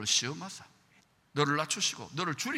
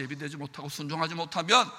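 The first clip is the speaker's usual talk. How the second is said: ordinary talk, nothing marked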